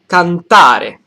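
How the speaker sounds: "cantare" with TA loud and high, falling onto re; stress on middle syllable. Shown as a demonstration. Teacher showing Italian word stress, hitting the TA of "cantare". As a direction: punch the TA in "cantare". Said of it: "the syllable in the middle".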